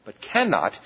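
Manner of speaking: "cannot" pushed out as the key word; stress on CANNOT. Real, not acted. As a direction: punch "cannot" to rule out any choice. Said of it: "I emphasize the word cannot".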